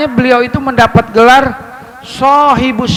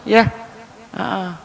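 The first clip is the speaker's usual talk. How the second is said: normal talking voice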